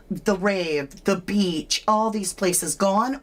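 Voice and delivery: normal talking voice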